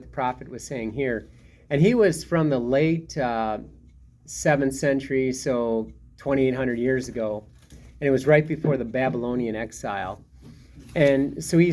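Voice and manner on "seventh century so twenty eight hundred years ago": ordinary delivery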